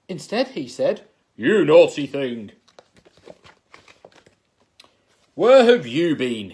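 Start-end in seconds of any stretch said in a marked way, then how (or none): 1.3-6.5 loud, booming royal scold; thundering king. Acted. King scolding his runaway niece, booming. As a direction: thunder it at her like an angry king. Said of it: "he thundered".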